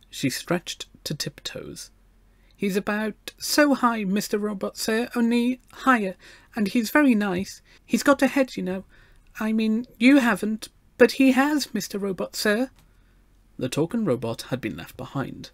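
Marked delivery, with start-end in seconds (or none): 2.6-12.7 put-on high, earnest little girl; treble child voice. A narrator's reading. Gloria describes her robot friend to the machine, earnest treble. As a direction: explain it in a small, high, polite voice. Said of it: "she raised her treble voice".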